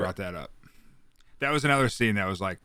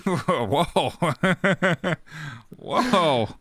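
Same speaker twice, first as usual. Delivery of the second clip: high laughing whoa; 7 semitones higher, 4 dB louder than usual. Real, not acted. Laughing reaction to joke.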